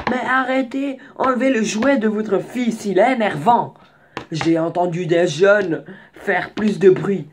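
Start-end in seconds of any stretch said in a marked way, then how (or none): 0.1-3.8 complaining grandfather, higher; grumpy old man. Retold or imitated. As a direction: nag like an irritated old man. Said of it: "il a commencé à dire". (vieux grincheux qui râle)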